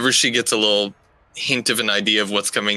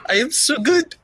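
very high, gleeful character squeal; delighted self-praise. Acted. Adelaide claps at her successful prediction, gleefully self-satisfied. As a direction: revel in your own cleverness.

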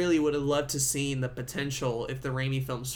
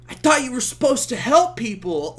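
high, loud, hammed-up indignant protest; cheesy movie plea; 8 semitones higher, 7 dB louder than usual. Harry protests to Spider-Man in a cheesy scene, hammed-up indignation. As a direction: play it as an overwrought bad-movie line. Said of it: "really cheesy".

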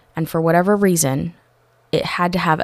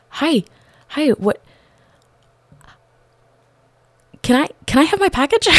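high, fast, breathless asking; pitched up in happy shock; excited disbelief; 9 semitones higher than usual. Retold or imitated. To the UPS driver, high and fast in disbelief. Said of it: "I get out and I'm like".

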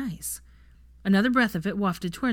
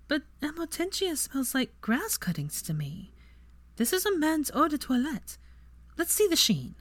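higher, lighter voice for Granger; Granger's voice; 10 semitones higher than usual. A narrator's reading. Narrator voicing Granger, puzzled and curious. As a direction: play her as puzzled and curious.

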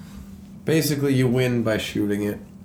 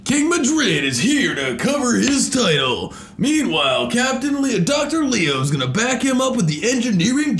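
monster truck ad; 8 semitones higher, 3 dB louder than usual. Pretend. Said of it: "look, look, look".

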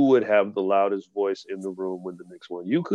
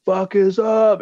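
mock hype shout; 9 semitones higher, 5 dB louder, slower than usual. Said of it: "he grabbed the mic and said".

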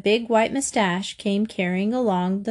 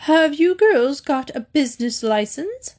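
sharp, higher-pitched snap; snapped; 6 semitones higher than usual. A narrator's reading. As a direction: snap it at the girls as a challenge. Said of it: "she snapped".

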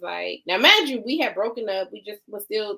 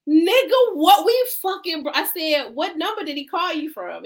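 high, loud retold protest; indignant outburst; 8 semitones higher, 6 dB louder than usual. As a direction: burst in with exasperated disbelief.

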